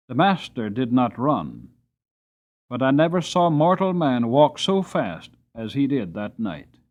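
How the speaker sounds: plain reading voice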